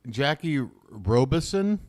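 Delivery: ordinary talk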